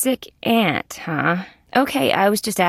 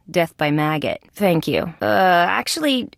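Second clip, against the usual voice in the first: normal talking voice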